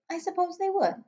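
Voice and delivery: put-on little girl voice. Sophie's voice.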